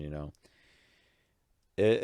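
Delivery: ordinary talk, nothing marked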